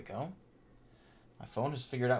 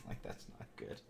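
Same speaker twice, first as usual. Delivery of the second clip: whispered; 16 dB quieter than usual. Real, not acted. Stream staggering, muttered aside.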